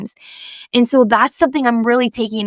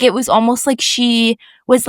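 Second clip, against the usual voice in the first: ordinary talk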